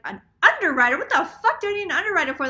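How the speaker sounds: hammed-up disbelief, spitting it out; retold outrage. Retelling the bank call to viewers, loud mock outrage.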